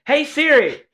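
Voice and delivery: high, loud summons; calling the voice assistant. Real, not acted. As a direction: call out sharply to get a response. Calling unresponsive assistant repeatedly.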